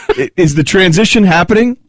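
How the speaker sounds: ordinary talk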